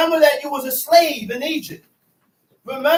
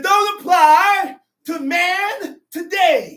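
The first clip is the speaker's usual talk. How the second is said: tearful lament; 5 semitones higher, 6 dB louder, slower than usual. Acted. Preacher laments emotionally.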